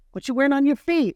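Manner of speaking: sassy check of her shoes; scolding friend. Retold or imitated. Friend scolding her about flat shoes, high and sassy.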